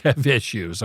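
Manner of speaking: normal talking voice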